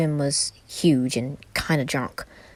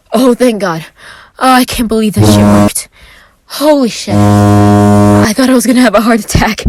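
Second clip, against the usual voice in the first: relief; 5 semitones higher, 12 dB louder than usual. A narrator's reading. Relieved outburst after bluff.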